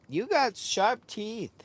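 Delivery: high and slow, chiding the dog; high, slow teasing. Real, not acted. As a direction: draw it out up high at the pet. Teasing the dog about biting.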